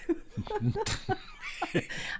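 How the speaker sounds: high, giggling laugh. Real, not acted. Host laughing at her Love Boat joke, high giggle. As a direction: laugh at your own joke.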